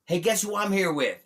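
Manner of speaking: high, loud teasing phone voice. Retold or imitated. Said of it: "And he's like".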